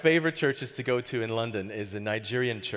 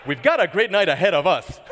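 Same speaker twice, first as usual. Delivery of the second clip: Nigerian pastor hyping; 3 semitones higher, 11 dB louder than usual. Retold or imitated. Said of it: "He goes".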